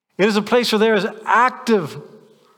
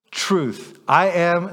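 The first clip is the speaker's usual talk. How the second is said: usual speaking voice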